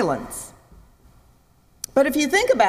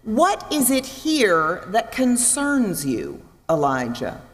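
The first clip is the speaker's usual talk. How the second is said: slow gentle question; slower than usual. Shown as a demonstration. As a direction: ask it slowly, with care. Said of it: "translates it a little bit differently".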